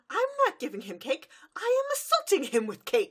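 gleeful hyper pony; manic excitement. Retold or imitated. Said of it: "And Pinkie Pie goes".

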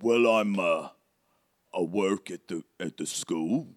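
hesitant character voice; bumbling, halting storybook helper; Hagrid. Acted. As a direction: fumble for the word in a put-on accent.

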